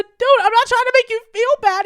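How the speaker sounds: rushed overjoyed protest, voice jumping up; excited protest. Retold or imitated. Retelling her protest at the drive-thru window.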